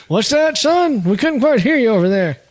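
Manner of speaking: high-pitched, teasing put-on call; hammed-up calling across a distance; mock not hearing. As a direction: play at being unable to hear, pitched high. Mock not hearing the other speaker.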